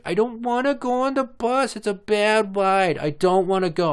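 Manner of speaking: put-on high whiny child voice; whiny toddler. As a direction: whine it like a three-year-old. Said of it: "the three-year-old's like".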